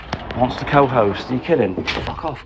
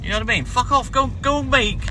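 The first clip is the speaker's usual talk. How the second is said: pitched-up furious telling off; angry rant; 5 semitones higher than usual. Real, not acted. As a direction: vent at the clout chasers. Ranting at live co-host requests.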